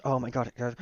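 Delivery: ordinary delivery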